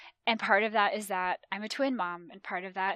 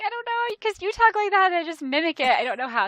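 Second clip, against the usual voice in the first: much higher and louder, helpless mock reply; sheepish excuse; 10 semitones higher, 11 dB louder, faster than usual. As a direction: pitch it way up, playing the helpless mimic.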